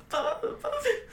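laugh-sob. Real, not acted. Crying at Lord of the Rings.

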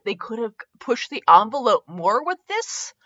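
ordinary delivery

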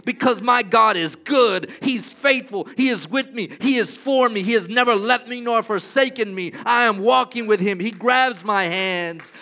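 loud, swelling preacher's proclamation; preaching at full force. Acted. Pastor declaring God's faithfulness to the congregation, loud and fervent. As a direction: lift the room with bold declarations.

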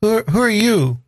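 put-on high, hesitant child's voice; little boy. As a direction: stammer it as a confused eight-year-old. Player voices eight-year-old Carl.

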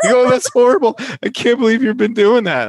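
put-on high, loud, horrified kid voice; a kid recoiling in disgust, high and loud. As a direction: squeal it like a kid who just gagged. Kid reacting to neti pot.

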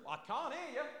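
high, softened far-off call; imitated distant shout. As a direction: mimic mum calling from another floor. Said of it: "she shouted from downstairs".

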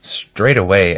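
ordinary delivery

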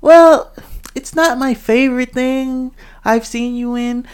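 high-pitched, tactful mock hedging; exaggerated sugarcoating. Shown as a demonstration. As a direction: put on a very high, careful, let-them-down-easy voice. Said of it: "sugarcoating is like, it's making it easy".

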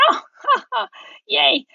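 high mock-cheerful laugh and yay; sarcastic yay. To herself as the dog brawls, high sarcastic cheer. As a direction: fake a bright little cheer at bad news. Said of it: "I'm like".

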